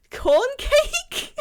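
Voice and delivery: high laughing question; real amused disbelief; laughing surprise. Real, not acted. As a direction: squeal the name through a laugh. Amused by a silly name.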